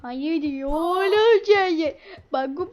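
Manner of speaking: ordinary talk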